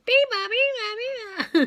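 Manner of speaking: high chirpy bird call; high mimicked birdsong; bird call imitation. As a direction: sing it like a little bird. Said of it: "they always sound like they're saying".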